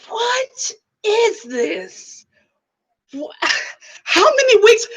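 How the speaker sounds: slow, cracking half-sob; mock crying. Real, not acted. Fan lamenting the ending, comic half-sob. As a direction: whimper it out in comic despair. Distraught over the show.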